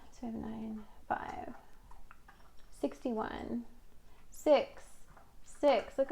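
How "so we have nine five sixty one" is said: counting cards to herself in a whisper. Real, not acted. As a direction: whisper the numbers under her breath.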